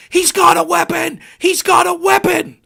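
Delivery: high, loud panicked yell, imitating protesters; mimicking a shrill crowd cry of alarm; mock screaming. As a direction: shout it like a frightened accuser. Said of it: "they start screaming".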